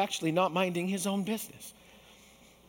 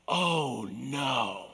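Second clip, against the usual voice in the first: dawning dread; 5 semitones lower than usual. Retold or imitated. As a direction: realize the failure, sinking. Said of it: "I picture Peter responding this way".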